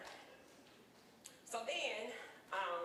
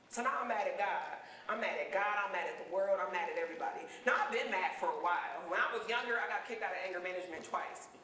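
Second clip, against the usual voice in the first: ordinary talk, nothing marked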